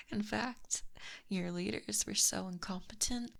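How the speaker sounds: normal talking voice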